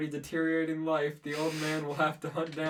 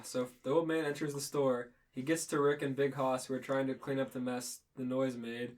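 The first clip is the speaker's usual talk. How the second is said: ordinary talk, nothing marked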